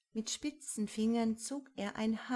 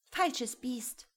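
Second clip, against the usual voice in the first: hissed; 6 semitones higher than usual. A narrator's reading. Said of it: "hisste Rosemarie".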